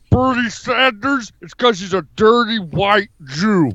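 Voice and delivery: hammed-up tough-guy politician impression; comic strongman bluster; Jesse Ventura. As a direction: declare it like a blustering off-grid candidate. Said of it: "Okay, Jesse".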